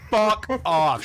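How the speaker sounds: loud, high outburst; loud fuck off. Real, not acted. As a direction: blurt it out loudly. Reacting to teasing.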